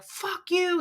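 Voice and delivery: high-pitched retort, volume held back; mock angry yell. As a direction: imitate his outburst without real loudness. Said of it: "started to get really loud".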